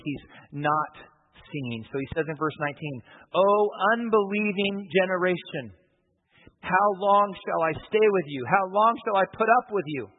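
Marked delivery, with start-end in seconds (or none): 3.3-5.8 higher, louder and slowed, scolding a faithless crowd; exasperated rebuke. Retold or imitated. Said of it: "Jesus is exasperated". 6.6-10.1 exasperated rebuke. Retold or imitated. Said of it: "Jesus is exasperated".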